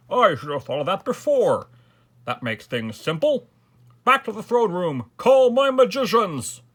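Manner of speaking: high, loud orders building to a shout; rally the court, ending at full volume; commanding king. A narrator's reading.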